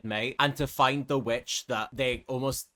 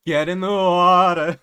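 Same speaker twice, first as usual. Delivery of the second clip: slow, drawn-out and much louder eerie command; louder, slower menacing siren; 3 semitones higher, 9 dB louder than usual. Acted. Bianca pressing Argus harder to enter water, slow loud eerie order. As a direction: push the threat harder, slowly. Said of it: "That's kind of what she's like".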